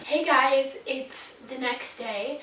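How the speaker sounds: ordinary talk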